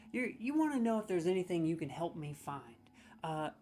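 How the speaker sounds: ordinary delivery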